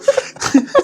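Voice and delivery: squeaky incredulous laugh; high laugh. Retold or imitated. Mocking the talk of enemies, a high incredulous laugh. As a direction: laugh at the absurd idea. Mocking his own confusion.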